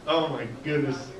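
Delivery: normal talking voice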